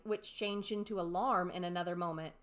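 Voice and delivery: ordinary delivery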